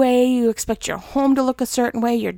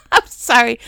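real laughter at her own sarcastic joke; laughing; 7 dB louder than usual. Real, not acted. A laughing apology after a joke about having no friends. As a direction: laugh high and loud, then apologize through it.

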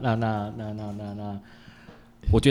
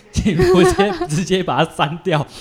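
high squeal then laughing; laughing plea; 9 semitones higher, 5 dB louder than usual. Real, not acted. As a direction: beg through laughter, joking. Joking plea to delete photos. (笑着半开玩笑地求对方删掉)

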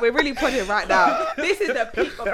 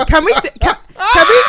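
loud pleading; 9 semitones higher, 10 dB louder than usual. Real, not acted. Overwhelmed, pleading.

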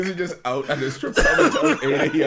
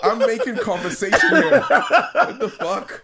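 high, louder, laughing protest; laughing mock outrage; 10 semitones higher, 6 dB louder, slower than usual. Man voices ignored texter, laughing and indignant. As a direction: play offended while cracking up.

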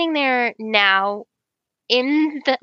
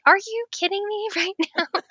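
incredulous; 9 semitones higher than usual. Retold or imitated. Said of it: "I'm like".

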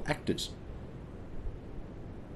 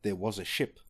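dramatic poem recitation; 4 dB quieter than usual. Acted. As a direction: recite it like an old ballad on stage. Recites the poem's opening lines.

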